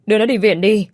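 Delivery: urgent. Acted. Father urging hospital.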